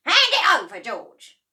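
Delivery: high, loud order; demanding Grandma. A narrator's reading. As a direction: demand the cup. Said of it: "Grandma said".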